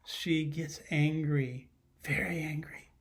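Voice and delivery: hushed whisper, voice dropped away. A narrator's reading. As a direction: whisper it as a secret. Teacher qualifies explosion for children.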